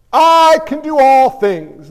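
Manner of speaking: booming Paul. Acted. Preacher acting out Paul's claim.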